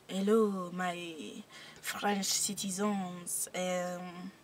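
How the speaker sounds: soft, slow French drawl; hushed put-on French accent. Shown as a demonstration. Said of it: "we haven't put down French".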